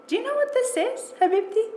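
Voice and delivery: a hint of a heavy foreign accent, kind and curious; gentle heavily accented English, savouring the words. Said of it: "heavily accented English fills the room".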